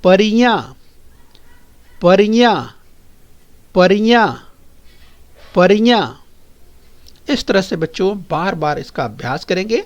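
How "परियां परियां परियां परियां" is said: परियां with a nasal final aa, louder; clear loud drill. Shown as a demonstration. Teacher drilling परियां for the class, loud and clear. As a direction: say परियां firmly with a nasal end. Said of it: "अगला शब्द सुनिए".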